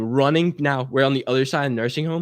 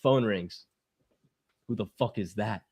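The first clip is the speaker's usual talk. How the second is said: usual speaking voice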